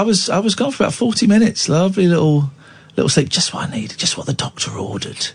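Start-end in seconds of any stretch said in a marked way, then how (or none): 3.3-5.3 soft breathy whisper. Real, not acted. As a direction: whisper it to yourself. Whispered aside after a nap.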